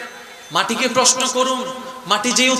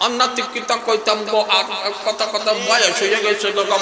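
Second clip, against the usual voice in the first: put-on toothless old man; 5 semitones lower, 4 dB quieter than usual. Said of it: "তাদের কথা কইলে দেখবেন".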